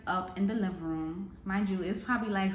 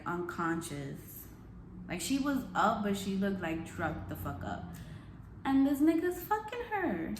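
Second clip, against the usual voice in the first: ordinary talk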